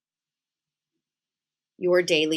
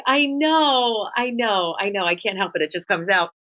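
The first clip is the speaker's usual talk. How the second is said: higher, louder quick I know; eager excited admission; 4 semitones higher, 3 dB louder than usual. Retold or imitated. Daughter answering her mom's scolding, higher and excited. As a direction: blurt it out, unable to stop. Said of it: "And I'm like".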